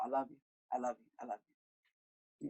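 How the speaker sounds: soft, tender I love you. Retold or imitated. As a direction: say it softly and lovingly. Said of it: "Every phone call".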